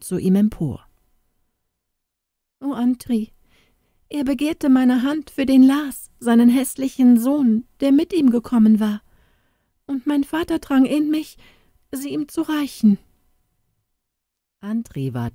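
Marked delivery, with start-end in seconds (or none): 2.6-13.0 put-on high, urgent girl's voice, faster in the middle; distressed young girl. A narrator's reading. Inga telling Andri her father wants her wed to Lars. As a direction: pour out the bad news in distress.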